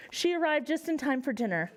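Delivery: plain reading voice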